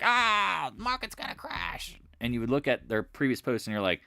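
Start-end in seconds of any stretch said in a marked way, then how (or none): 0.0-2.0 high-pitched mock panic wail; panicky poster. Trader mimicking random chat posters, in mock panic. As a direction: cry out like a hysterical doomsayer. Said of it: "just post like".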